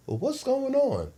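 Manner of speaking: high-pitched imitation of mom; mom's questioning voice. Voices his mom walking in.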